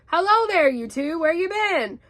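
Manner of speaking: bouncy, loud, high swooping greeting; Tigger. A narrator's reading. Tigger greets the friends he bounced, exuberant. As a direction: greet them with boisterous swoops of pitch. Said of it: "It's Tigger!".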